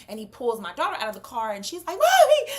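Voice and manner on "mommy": high, loud imitation of a child calling; mimicked shriek for mother; child's frightened scream. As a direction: cry out like a scared daughter. Daughter pulled out of car.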